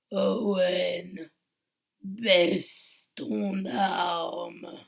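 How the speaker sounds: very slow, loud, menacing order; cavernous voice. Acted. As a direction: spell out each word like a threat. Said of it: "Une voix caverneuse profonde lui répond".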